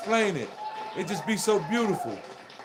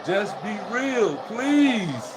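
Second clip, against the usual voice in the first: slow plea; 2 semitones higher, much slower than usual. Real, not acted. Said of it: "pleading to audience".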